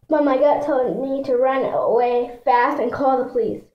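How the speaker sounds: usual speaking voice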